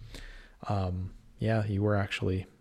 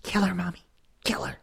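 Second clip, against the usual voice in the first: put-on childlike killer voice; 10 semitones higher than usual. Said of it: "work on my voices".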